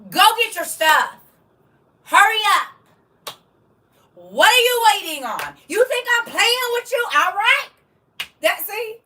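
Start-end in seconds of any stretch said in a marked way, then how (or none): 0.1-7.7 loud, higher impatient orders; stern auntie command. Shown as a demonstration. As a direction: push them to hurry up.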